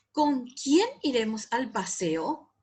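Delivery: "Con quién iremos al paseo" slowly asked, marked questioning tune; emphasized question. Shown as a demonstration. Teacher reading example questions, emphatically questioning. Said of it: "hay que enfatizar".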